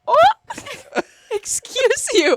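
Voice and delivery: high, loud, drawn-out mock outrage; mocking imitation. Student imitating the host across the gym, mocking. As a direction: exaggerate the teaser's call across the gym. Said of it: "He's like".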